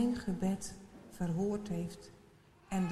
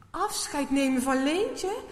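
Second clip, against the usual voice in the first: louder, higher, startled repeat of Leentje; shocked echo; 5 semitones higher, 8 dB louder than usual. A narrator's reading. Maarten repeating the helper's words in shock. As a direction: blurt it out in dismay. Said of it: "Maarten schrikt ervan".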